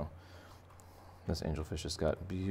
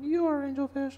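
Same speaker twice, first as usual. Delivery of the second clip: magic mirror voice. Acted. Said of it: "And he's like".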